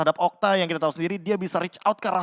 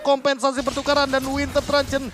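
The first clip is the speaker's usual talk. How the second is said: screaming play-by-play, much higher; esports caster; 8 semitones higher, 5 dB louder than usual. Real, not acted. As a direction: yell the big play at full hype. Hyped live caster call.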